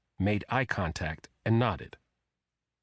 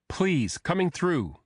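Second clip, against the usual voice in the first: calling out; 8 semitones higher, slower than usual. A narrator's reading. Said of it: "a woman called out".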